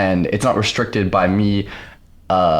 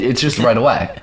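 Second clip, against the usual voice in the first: ordinary talk, nothing marked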